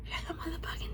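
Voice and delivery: hushed exasperated whisper. Real, not acted. Fed up with the dentist, a whispered curse. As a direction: spit it out under your breath.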